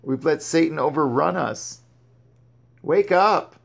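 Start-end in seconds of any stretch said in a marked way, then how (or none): 2.8-3.6 high, louder wake-up cry; preacher's sharp call to wake up; urgent call. Real, not acted. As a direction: rouse the congregation. Preacher calling out to congregation.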